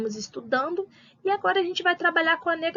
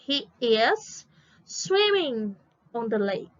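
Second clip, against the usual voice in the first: slow, stretched English example; slow modelling; 3 semitones lower, much slower than usual. Shown as a demonstration. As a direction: spell out the sentence word by word at half speed. Teacher models example sentence slowly. (exemplo lento, palavra por palavra)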